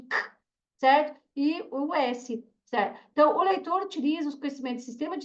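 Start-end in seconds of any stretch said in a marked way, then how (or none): 0.0-0.3 "K" as a short bare consonant, no vowel; som de K. Shown as a demonstration. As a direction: click out only the K phoneme. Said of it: "que tem o mesmo som de". (som isolado de "K", sem vogal)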